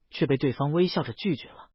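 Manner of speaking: ordinary delivery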